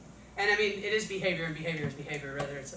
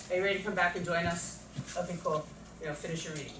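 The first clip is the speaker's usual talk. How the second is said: soft, lower, low-key teacher voice; gentle welcome back; 3 semitones lower, 6 dB quieter, faster than usual. Shown as a demonstration. Teacher letting a student rejoin after refocus, soft and casual. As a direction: quietly invite them back in.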